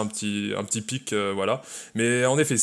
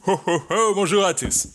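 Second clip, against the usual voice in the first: Santa voice; 6 semitones higher than usual. Acted. Santa laugh, Christmas talk.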